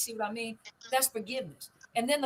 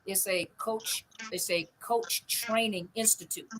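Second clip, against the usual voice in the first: "coach training institute" spelled out slowly, word by word; slow correction; slower than usual. Shown as a demonstration. Correcting a viewer's mistaken course name, slow and deliberate. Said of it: "So it's not a mental health coaching training".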